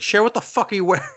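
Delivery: high, quick mock scolding; hammed-up fatherly outrage; outraged dad. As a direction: imitate a father aghast at his daughter's outfit. Dad scolds daughter's outfit.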